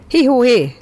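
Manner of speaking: high ringing shout of hi-hó-hé; loud call. A narrator's reading. Chief calls out to summon the boy, loud high shout. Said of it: "kiáltotta hangosan".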